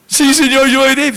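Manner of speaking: high, bright mock-eager promise; eager yes to God. Boy promising God a yes he doesn't mean, in a high eager voice. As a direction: chirp the yes up high, a little too easily. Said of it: "Así que".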